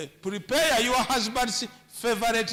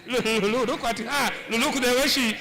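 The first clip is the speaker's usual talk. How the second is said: rising to a high, excited woo of admiration; whistle-like hoots at a passing woman; mock ogling; 4 semitones higher than usual. A man hooting at a woman as she walks by, excited.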